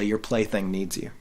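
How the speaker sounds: ordinary talk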